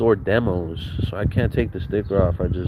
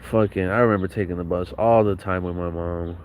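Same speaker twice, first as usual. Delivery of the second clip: ordinary talk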